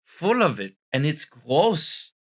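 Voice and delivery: hammed-up French accent on English; put-on French accent. French persona greeting his viewers, in a put-on French accent. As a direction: play a tired Frenchman mangling English. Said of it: "this French accent".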